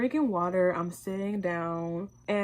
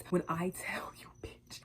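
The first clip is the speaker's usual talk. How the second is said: whispering; 7 dB quieter than usual. Real, not acted. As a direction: whisper it like a secret. Dramatic storytelling whisper.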